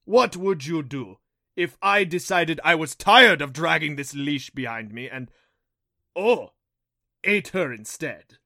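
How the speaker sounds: loud, toying villain voice; menacing Rompo beast. A narrator's reading. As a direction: threaten loudly, as if musing playfully. Rompo threatens the mother.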